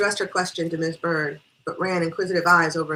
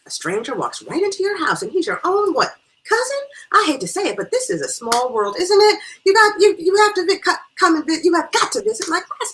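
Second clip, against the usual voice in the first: high, loud, tumbling over the words; gushing excitement; 13 semitones higher, 9 dB louder than usual. Acted. Guest inviting newfound cousin to class, breathless-with-delight gushing. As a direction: gush and stammer the invitation.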